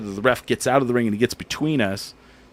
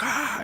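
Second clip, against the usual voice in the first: imitated crowd gasp; 6 dB quieter than usual. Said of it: "everybody's, like".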